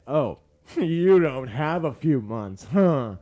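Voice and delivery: slow, taunting with a laugh; the doctor's daughter mockingly teasing John. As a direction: chuckle through it like you're teasing a dying man. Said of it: "she's like".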